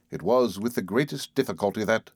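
plain reading voice

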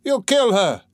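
high accusing cry; shouted. A narrator's reading. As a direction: call out a protest from the crowd. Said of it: "shouted the third".

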